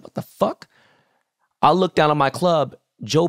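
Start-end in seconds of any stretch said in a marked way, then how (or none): 0.0-0.7 retold shock. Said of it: "So I said".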